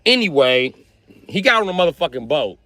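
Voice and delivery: usual speaking voice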